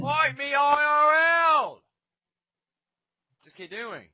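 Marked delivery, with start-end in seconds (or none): 0.0-1.8 shouted challenge. Real, not acted. Challenging opponent mid-fight.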